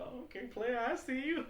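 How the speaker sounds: high, loud mock impressed call; impressed tease. Friend teasing a player at the table, high and impressed. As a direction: tease him like a hype man. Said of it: "I'm like".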